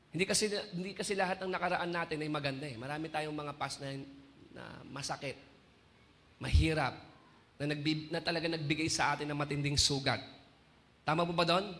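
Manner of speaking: low, soft, measured sermon; solemn preaching. Acted. Preacher tells the crying believer what God says, solemn hushed preaching. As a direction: drop down low and let each phrase settle.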